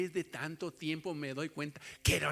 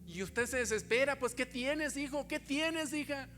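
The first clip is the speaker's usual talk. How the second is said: raised, higher pulpit voice, pressing each line; impassioned preaching; 10 semitones higher than usual. Acted. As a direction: preach it up high with urgency. Fervent preaching about babies.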